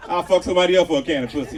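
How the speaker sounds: normal talking voice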